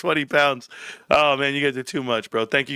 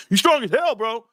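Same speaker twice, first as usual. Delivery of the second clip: put-on hyped young player; 6 semitones higher than usual. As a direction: pump up the old guy. Kid praising him.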